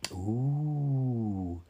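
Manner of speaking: long rising-then-falling "ooh"; appreciative ooh. A narrator's reading. Crowd impressed by Dee Dee's fortune, murmuring a long appreciative ooh. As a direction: murmur a drawn-out sound of admiration. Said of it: "the crowd murmured appreciatively".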